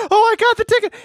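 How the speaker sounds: mock excitement. Retelling Charlie's birthday joke, high mock excitement. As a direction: squeal it like a kid faking a win.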